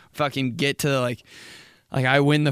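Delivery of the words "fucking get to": ordinary talk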